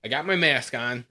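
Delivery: ordinary talk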